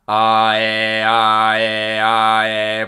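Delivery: loud alternating "Ah, eh", switching from open ah to twangy eh; ah to eh shift. Shown as a demonstration. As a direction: sing "ah, eh" back and forth, loud. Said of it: "I tend to go into an eh".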